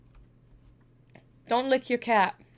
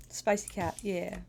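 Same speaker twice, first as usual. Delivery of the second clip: ordinary talk, nothing marked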